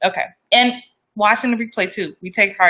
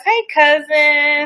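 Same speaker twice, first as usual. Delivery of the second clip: slow, high, sing-song hello; drawn-out greeting; 8 semitones higher, much slower than usual. Real, not acted. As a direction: stretch it out warmly to family.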